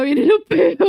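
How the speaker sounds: laughing tease. Real, not acted. Joking warning, laughing.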